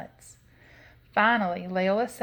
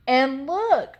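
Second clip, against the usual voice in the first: higher, louder mother bear cry; Mama Honey Bear; 6 semitones higher, 6 dB louder than usual. A narrator's reading. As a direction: cry out as the middle-sized bear. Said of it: "in her middle-sized bear voice".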